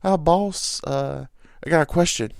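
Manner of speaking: imitating the new guy at work; coworker's voice. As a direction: put on his easygoing voice. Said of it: "This is his voice".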